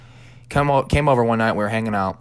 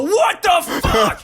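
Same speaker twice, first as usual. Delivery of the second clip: high, drawn-out loud yell; retold startled yell; 5 dB louder, much slower than usual. Telling friend how he reacted to the scare, startled yell. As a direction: yell it out high, stretching the words.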